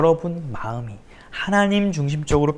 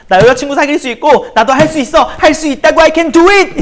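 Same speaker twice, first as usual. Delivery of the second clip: loud, high indignant shout; defiant yelling; 12 semitones higher, 11 dB louder than usual. Retold or imitated. Teen pastor yelling back at bullies, high and indignant. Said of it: "전 같았으면".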